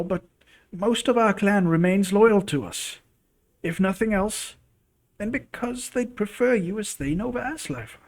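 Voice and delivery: put-on a gentle old mother voice; grandma voice. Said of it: "Sorry about the grandma voice".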